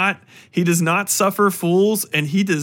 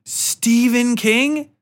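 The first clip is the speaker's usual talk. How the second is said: slow, drawn-out, higher rising "Stephen King?"; incredulous correction; 8 semitones higher, much slower than usual. Retold or imitated. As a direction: stretch "Stephen King?" out like she can't believe it. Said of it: "And she goes".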